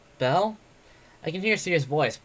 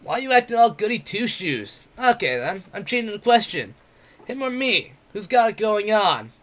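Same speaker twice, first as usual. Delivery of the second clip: higher, louder mocking brawler voice; brash, jeering tavern boaster; cocky werewolf adventurer; 7 semitones higher, 4 dB louder than usual. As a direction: taunt him like a swaggering bully. Bete voiced in the story.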